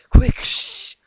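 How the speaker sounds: high, loud urgent hiss of a tomboy. A narrator's reading. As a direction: hiss the warning, excited and hurried. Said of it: "hissed Rainbow Dash".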